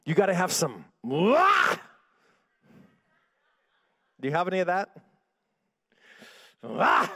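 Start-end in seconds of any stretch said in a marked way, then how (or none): 1.0-1.8 loud, high, rising "wah"; gritty battle yell. Shown as a demonstration. Preacher showing congregation what grit sounds like, sudden yell. 6.6-7.1 short, loud "wah"; gritty yell. Shown as a demonstration. Preacher asking who has any grit, short sharp yell.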